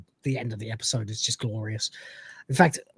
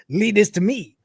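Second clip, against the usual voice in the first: higher, mock Mexican-Spanish accent; 4 semitones higher than usual. The cat takes charge at the saloon, mock Spanish accent. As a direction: put on the cat's Spanish accent. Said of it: "he's got this Spanish accent kind of".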